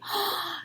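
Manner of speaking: a quick high delighted intake of breath; sharp gasp. A narrator's reading. Narrator gasps as mother and baby reunite, joyful gasp. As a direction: gasp with joy.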